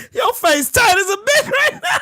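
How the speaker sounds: high taunt dissolving into laughter; laughing hard. Real, not acted. Streamer mocks rival's tight face, high laughing taunt. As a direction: squeal it out while cracking up. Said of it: "I ain't laughed this hard".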